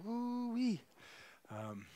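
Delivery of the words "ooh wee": high squealing mock scream; imitated scream. Preacher imitating his daughter's scream, high mock squeal. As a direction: squeal it like a shrieking little girl. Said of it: "if you've heard my daughter scream".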